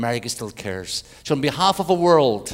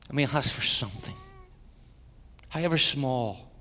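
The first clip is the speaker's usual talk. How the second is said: prayer; 3 semitones lower, 7 dB quieter than usual. Acted. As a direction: pray quietly over the congregation. Leads a prayer.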